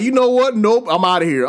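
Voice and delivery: high, loud imitation of a quitter; mock bailing-out. Player voicing Odogaron fleeing the locale, mock dismissive. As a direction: voice the monster giving up in a huff. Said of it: "he got, he like".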